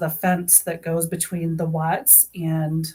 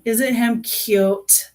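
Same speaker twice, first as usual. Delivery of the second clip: slow, high cooing at her pet; 4 semitones higher, 4 dB louder, much slower than usual. Real, not acted. Showing her pet, look at my baby.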